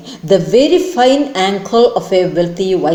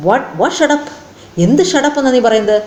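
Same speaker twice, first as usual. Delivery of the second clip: indignant yell, pitched higher; angry demand; 4 semitones higher than usual. A narrator's reading. Ajit rounding angrily on his wife, pitch higher. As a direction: demand an answer, riled up.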